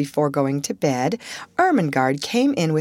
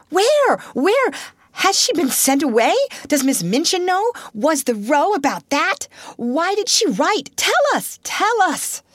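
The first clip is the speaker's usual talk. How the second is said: excited clamour; 11 semitones higher than usual. Acted. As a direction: pester her breathlessly. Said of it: "There was a perfect babel".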